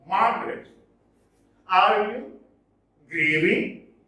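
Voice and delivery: verse read foot by foot with heavy stresses; stressed syllables marked. Shown as a demonstration. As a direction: lift and push each stressed syllable of the verse. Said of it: "The syllables in red are stressed".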